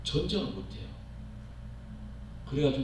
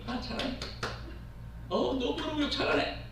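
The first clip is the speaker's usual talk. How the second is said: whispered 잘하네 then a much higher mock-sweet praise; sarcastic praise; 10 semitones higher, 7 dB quieter than usual. Ironic boy praising the neighbor kid who insults his dad. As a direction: pretend to admire the insult, ironically.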